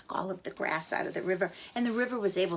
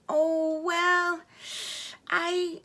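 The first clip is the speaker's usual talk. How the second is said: high, hesitant little chick; a chick making excuses, higher; 9 semitones higher than usual. A narrator's reading. Chick excusing himself to the river, high and hesitant.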